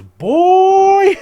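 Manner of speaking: very high, loud stretched shout; imitated warning yell. Mother warning the man beside her, mock high yell.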